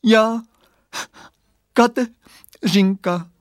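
high, loud, slow sobbing little voice; tearful little girl, halting and high; voice broken by crying. A narrator's reading. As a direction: tear the words into sobbed pieces. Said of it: "řekl hlásek pláčem potrhaný na kousky".

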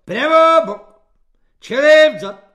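high, very loud parade-ground yell; squealed drill command. A narrator's reading. Corporal drilling his clumsy recruits, squealed commands. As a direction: squeal the orders at full volume. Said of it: "pišťal svobodník Halík".

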